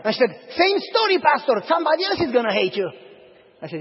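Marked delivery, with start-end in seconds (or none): 0.6-2.9 mock lady retort. Church lady retorts to pastor.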